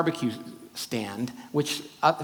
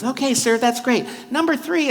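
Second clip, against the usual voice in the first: much higher, louder, bright and eager; eager teen worker; 9 semitones higher, 5 dB louder than usual. Retold or imitated. Excited young worker calling an order, high and loud. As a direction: sell it like a thrilled seventeen-year-old. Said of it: "he's all excited".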